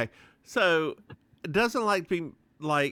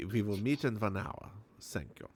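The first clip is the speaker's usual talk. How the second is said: put-on old man's German accent, lightly done; 4 semitones lower, 7 dB quieter than usual. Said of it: "Sells my German accent".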